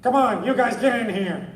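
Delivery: high, loud barking cop guard; NYPD guard. Shown as a demonstration. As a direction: yell at them like a tough guard. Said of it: "that time I played a guard".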